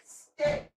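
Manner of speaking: sharp, high call to the dog across the room; firm high-pitched stay, called out; called-out command. Real, not acted. Owner calling the dog to stay, high and firm.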